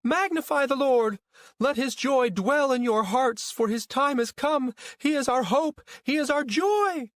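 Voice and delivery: very high, loud religious rapture; exultant proclamation. A narrator's reading. Mother blessing the crowds behind the truck, exultant proclamation. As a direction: spout praise to the whole street. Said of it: "spouting any Magnificat that came to mind".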